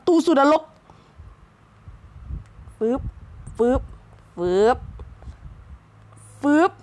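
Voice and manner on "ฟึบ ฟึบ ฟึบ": usual speaking voice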